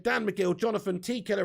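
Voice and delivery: normal talking voice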